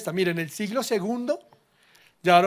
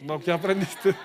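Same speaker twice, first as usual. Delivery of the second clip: laughing retort. Retold or imitated. Pastor asks again laughing.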